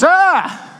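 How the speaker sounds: high startled cry in another voice; startled yelp. Retold or imitated. Pastor voicing startled stranger, high yelp. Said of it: "And then he goes".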